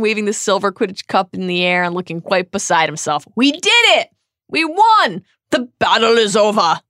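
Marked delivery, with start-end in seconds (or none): 3.3-6.8 loud, high victory cries; triumphant yell. A narrator's reading. Ron waving the Quidditch cup at his friends, yelling in triumph. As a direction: shout the win out, pitched high. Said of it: "yelled Ron".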